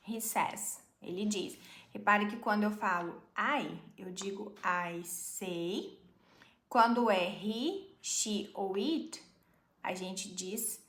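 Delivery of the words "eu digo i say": usual speaking voice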